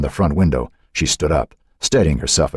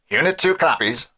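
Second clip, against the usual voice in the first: high voice half lost in static; faint radio reply; 10 semitones higher than usual. A narrator's reading. Deputy answers his chief through static, faint high radio voice. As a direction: acknowledge over a poor radio link. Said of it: "voice was barely audible, almost lost".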